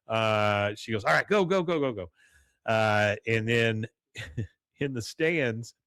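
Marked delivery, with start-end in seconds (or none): 1.0-2.1 mildly raised, urging voice; urgent send-off. Retold or imitated. Peggy sending the girls onto the field, mildly urgent. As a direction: hurry them along, acted lightly. Coach figure sends girls running.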